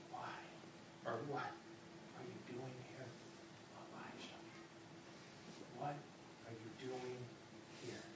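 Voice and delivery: breathy hushed whisper of God; God whispering to Elijah in the cave; low whisper. Acted. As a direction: whisper it, barely there. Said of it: "the sound of a low whisper".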